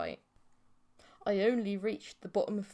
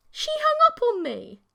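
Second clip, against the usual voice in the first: outraged; 9 dB louder than usual. A narrator's reading. Outraged narration.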